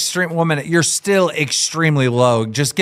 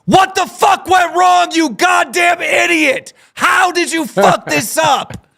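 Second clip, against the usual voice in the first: high, loud, slow furious yelling; angry shout; 4 dB louder, slower than usual. Acted. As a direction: scream insults at the loser. Voicing furious loser at opponent.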